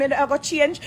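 usual speaking voice

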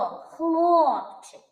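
high call of "Хлопчик", long О. Shown as a demonstration. Teacher models calling the boy, high calling "ХЛООпчик". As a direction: call the boy, ХЛООО-пчик. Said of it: "А хлопчика спробуйте покликати".

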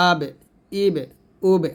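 আব, ইব, উব with a short echo after each B; qalqalah. Shown as a demonstration. Teacher models for students how to echo আব, ইব, উব, qalqalah. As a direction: bounce the closing B in আব, ইব, উব. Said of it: "এরকমভাবে আপনারা কলকলা করবেন".

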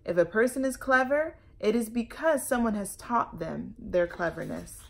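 put-on lively, loud read-aloud for children; storytelling read-aloud. A narrator's reading. Librarian reading a picture book to kids, lively.

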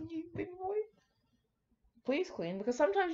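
high, soft cooing to the glasses. Real, not acted. Talking sweetly to his glasses.

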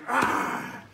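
loud growling roar; lion roar. Shown as a demonstration. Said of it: "make that sound like a lion roar".

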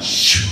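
imitated swish of paper; a drawn hushing "shh" for a turning page; page-turning sound. Test-taker hears classmates changing pages, as an imitated paper swish. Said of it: "sound of everybody changing pages".

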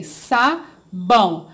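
"Sabão" said slowly as "sa-bão", syllables pulled apart; teacher showing kids how to split a word. Shown as a demonstration. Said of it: "e separei".

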